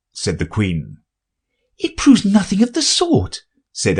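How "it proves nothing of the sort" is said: put-on high girlish voice; Alice, high and polite. Alice objects.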